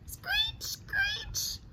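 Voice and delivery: very high, fading to a whispered screech; owl screech. Acted. Reading the owl's screech to kids, high then whispered. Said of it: "The owl screeched".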